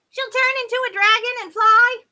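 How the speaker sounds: high, shouted mishearing; half-deaf fairy. A narrator's reading. Half-deaf fairy mishearing the curse, half-deaf. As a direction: pitch it way up, loud and questioning. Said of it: "who was half deaf".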